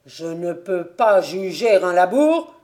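loud, angry challenge; choking anger. A narrator's reading. Invalid son challenging father over his ability, angry. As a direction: throw the challenge out loudly. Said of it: "suffoquant de colère".

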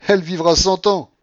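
high, very loud parting cry; shouting. A narrator's reading. Blanche to the general as she leaves, shouting. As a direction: hurl it back while leaving. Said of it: "en criant au général".

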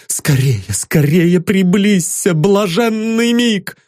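rapturous. Acted. Rapturous woman calls out.

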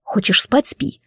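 understated gruff rebuke; gloomy reprimand. A narrator's reading. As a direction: scold gloomily without raising the voice. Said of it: "хмуро сделал мне замечание".

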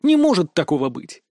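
plain reading voice

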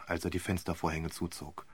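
ordinary delivery

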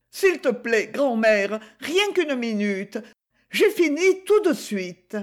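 lightly voiced young Gigi, protesting; Gigi, young girl. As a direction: play the girl, defiant and earnest. Gigi pleads with grandmother.

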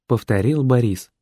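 ordinary delivery, nothing marked

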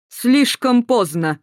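громко и медленно, грубо; отрезать с угрозой; рявкнул. A narrator's reading. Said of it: "рявкнул командир". (командир грубо рявкает на Эстер)

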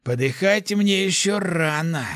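high, drawn-out defiant croak; hoarse, unnatural voice. A narrator's reading. Wounded hunter refusing to die, hoarse and defiant. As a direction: strain it out slowly, refusing to die. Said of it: "не своим голосом прохрипел он".

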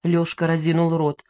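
plain reading voice

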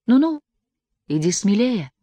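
put-on high coaxing order from the girl; commanding girl. A narrator's reading. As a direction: urge him on, teasingly. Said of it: "приказала девочка". (повелительный голос девочки)

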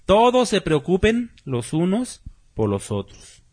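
"todos se preocupen los unos por los otros" said slowly, each word weighed; slow it down so the verse sinks in; slow emphatic repeat. Shown as a demonstration. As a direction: pastor repeating a verse slowly for his congregation. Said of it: "Vean eso".

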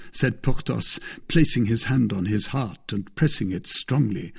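ordinary delivery, nothing marked

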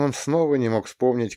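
ordinary delivery, nothing marked

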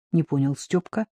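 ordinary delivery, nothing marked

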